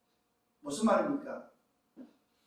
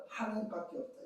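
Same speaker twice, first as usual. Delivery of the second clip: usual speaking voice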